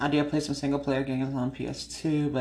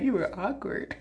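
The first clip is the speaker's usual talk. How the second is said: laughing mock; 6 semitones higher, 4 dB quieter than usual. Mocking his excuse.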